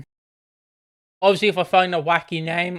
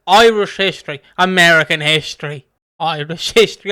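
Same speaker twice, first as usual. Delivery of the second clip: mock complaint; 8 dB louder than usual. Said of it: "I'd be like".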